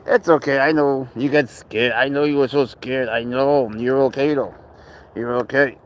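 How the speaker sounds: there there, you're safe; soothing pet voice. Real, not acted. Consoling his frightened cat.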